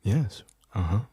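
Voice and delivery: low-pitched, cool listener noises; cool acknowledgement. Shown as a demonstration. Host showing co-host how cool he sounds, acting cool. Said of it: "listen how cool I sound".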